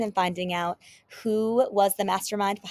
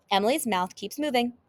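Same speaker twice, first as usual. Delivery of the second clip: ordinary delivery, nothing marked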